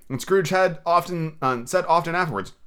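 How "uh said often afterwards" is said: ordinary delivery, nothing marked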